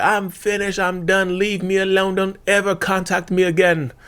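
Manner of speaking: put-on high-pitched, hammy British accent; mock-British voice, held high throughout; horrible British accent. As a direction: put on a bad British accent and dismiss them for good. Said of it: "Maybe not in that horrible British accent".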